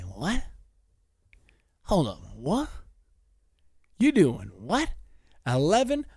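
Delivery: mock disbelief, rising to a high "Eleven?". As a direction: act stunned by the news, pitch climbing. Reacting to an 11-year-old racer.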